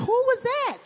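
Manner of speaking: high, breathless gawking onlooker; hammed-up shocked bystanders in the street; gossipy bystanders. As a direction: gasp it out like a nosy passerby. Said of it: "all these people are around looking around like".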